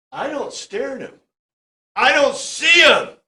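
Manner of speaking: dry sarcasm, the second line jumps up high and loud; mock-innocent sarcastic dodge; sarcastic reply. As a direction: play it like a smart-aleck brushing off the question. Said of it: "satire and sarcasm going on here".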